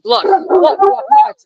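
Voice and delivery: high-pitched, insistent calls for attention; calling to the dog. Real, not acted. Owner to her dog mid-video, high urgent calling.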